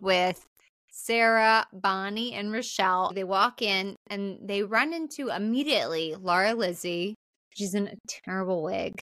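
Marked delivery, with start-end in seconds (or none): none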